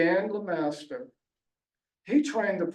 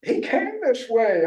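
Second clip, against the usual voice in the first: sheepish and high; meek excuse, a little higher; 6 semitones higher, 4 dB louder than usual. Retold or imitated. Dog owner excusing herself to trainer, sheepishly. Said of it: "I said kind of sheepishly".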